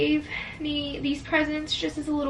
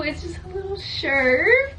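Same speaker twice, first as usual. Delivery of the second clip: ordinary talk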